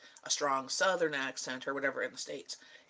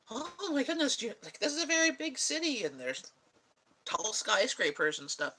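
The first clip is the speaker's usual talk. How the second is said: much higher, gushing mock amazement; amazed tourist; 6 semitones higher than usual. Florida friends visiting a Canadian city, mock amazed. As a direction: gush over the big city like a wide-eyed visitor. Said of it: "and they're like".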